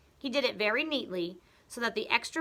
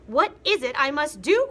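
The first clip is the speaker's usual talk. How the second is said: high, loud panic; wife desperate; clutching-cheeks Mrs Twit; 7 semitones higher, 10 dB louder than usual. Acted. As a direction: cry it out. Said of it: "cried Missus Twit".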